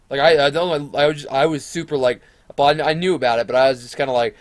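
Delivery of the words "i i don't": usual speaking voice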